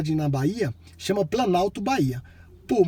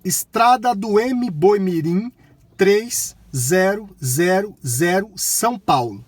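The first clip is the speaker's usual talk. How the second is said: loud, slow, word by word; talking to voice assistant; 5 dB louder, much slower than usual. Real, not acted. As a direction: dictate it clearly to the car.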